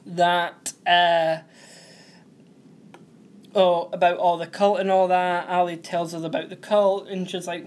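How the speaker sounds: ordinary talk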